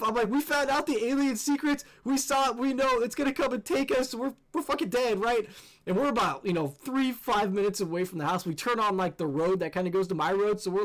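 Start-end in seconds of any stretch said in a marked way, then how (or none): 0.3-5.5 high, urgent doom-saying; retold panic. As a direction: spill it out in hammed-up panic. Retold panic with his girlfriend.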